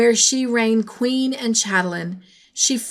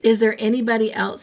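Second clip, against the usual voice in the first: usual speaking voice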